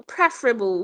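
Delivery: "preferable" as PREF-ruh-bul; first syllable of "preferable" stressed, PREF; correct pronunciation of preferable. Shown as a demonstration. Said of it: "don't say preferable or preferable".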